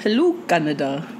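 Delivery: low, put-on Zimbabwean accent on "Hello, Canada". Shown as a demonstration. Cohost greets Canada in mock Zimbabwean accent. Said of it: "the best Zimbabwean accent I've heard".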